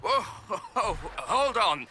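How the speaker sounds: nervous laugh. Acted. Lord caught off guard, nervous laugh.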